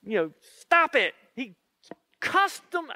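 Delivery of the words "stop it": high, abrupt mock scolding; mock outburst. Peter cussing out his accusers, mock outburst. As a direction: cut them off sharply, hammed-up.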